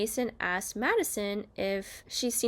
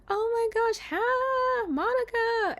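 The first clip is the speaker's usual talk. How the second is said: very high, gushing mock greeting; Joey; 13 semitones higher, slower than usual. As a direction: imitate an overexcited hello, pitched way up. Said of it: "Joey's kinda just being himself".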